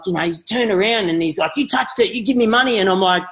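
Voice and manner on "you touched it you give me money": high mock kid voice, insistent; demanding street kid. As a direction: hustler kid claiming his due. Boy demanding payment.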